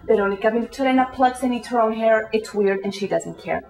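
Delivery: low, measured reading voice; headline read-out. Acted. Reader announcing the feature cover story, low headline read-out.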